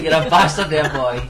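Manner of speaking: loud, laughing dad impression; sketch dad. Echoing friend's quote of the sketch, loud mock dad. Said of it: "and then the dad's like".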